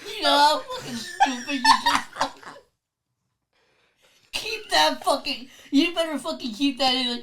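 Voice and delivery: high, helpless laughing scolding; laughing disbelief. Real, not acted. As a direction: scold a friend while cracking up. Cracking up at friend's silly claim.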